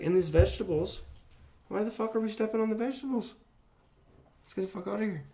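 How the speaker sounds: high, urgent, incredulous voice; panicked protest. Retold or imitated. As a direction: sound frantic and outraged. Retold panic over trampled vegetables.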